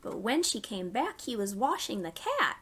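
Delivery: gentle storytime rhyme; reading to children. Acted. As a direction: read it to little ones, pausing for them. Reads a nursery rhyme.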